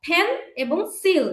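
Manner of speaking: ফেন and সিল as two separate stressed beats; split into syllables. Shown as a demonstration. Teacher showing two parts of pencils, ফেন and সিল said separately. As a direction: break it apart into ফেন, then সিল. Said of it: "কিভাবে হয় দেখো".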